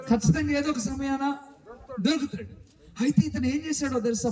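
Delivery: ordinary talk, nothing marked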